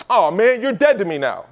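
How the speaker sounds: mock dismissive. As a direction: ham up a snooty dismissal. Mocking people dropping leads.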